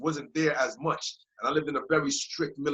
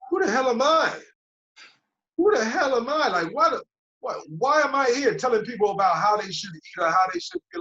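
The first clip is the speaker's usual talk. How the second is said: much higher, louder self-reproach; exasperated outburst; 8 semitones higher, 4 dB louder than usual. Retold or imitated. Realising he lectured people, higher and louder outburst. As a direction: cry it out at yourself, fed up. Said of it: "And I was like, I was like".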